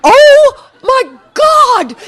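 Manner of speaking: high, loud shocked shout; panicked cry. Retold or imitated. Bus driver screaming in panic at the jump. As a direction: cry out in terror as the kids fly up.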